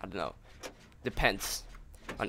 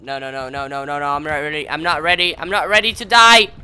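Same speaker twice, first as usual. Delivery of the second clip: building panic; 10 dB louder than usual. Real, not acted. Gamer facing sacrifice in the game, building panicked pleading. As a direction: panic climbing as death nears.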